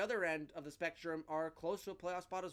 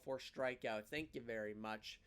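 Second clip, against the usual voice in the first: ordinary talk, nothing marked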